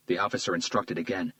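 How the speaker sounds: plain reading voice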